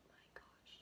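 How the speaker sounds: breathless unvoiced whisper; awed whisper. Real, not acted. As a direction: whisper it in amazement. Amazed at the light mist.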